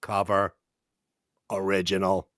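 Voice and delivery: flat robotic monotone. Shown as a demonstration. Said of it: "All I want out of you is a robot answer".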